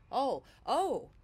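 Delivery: flustered, surprised; caught off guard. Acted. Surprised reaction.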